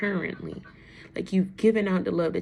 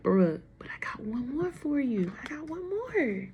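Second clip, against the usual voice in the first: high, drawn-out mock pleading; coaxing divine plea; 7 semitones higher than usual. Reader voices the Divine offering one more, high drawn-out coaxing.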